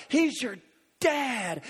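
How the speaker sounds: ordinary talk, nothing marked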